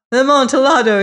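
high, loud, eager the Amontillado; eager Fortunato. Acted. Fortunato pleading with Montresor: high, eager voice. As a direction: grab at the Amontillado, high and hopeful. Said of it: "The voice said".

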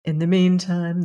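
plain reading voice

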